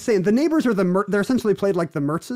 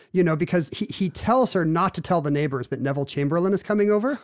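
ordinary talk, nothing marked

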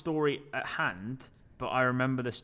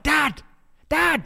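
put-on excited kids; 13 semitones higher, 8 dB louder than usual. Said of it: "the two kids sat in the back are like".

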